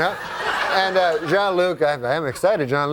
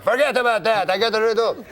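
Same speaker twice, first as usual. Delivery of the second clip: put-on eager, higher French-Canadian accent; Jean-Luc impression; 6 semitones higher than usual. Uncle hypes the riddle to his wife, higher French-Canadian accent. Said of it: "That's how he talks".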